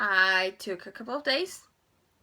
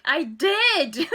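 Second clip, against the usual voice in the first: higher, louder mock protest; retold outburst, raised and loud; indignant retort; 8 semitones higher, 6 dB louder than usual. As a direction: fire it back, half-joking. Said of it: "And I was like".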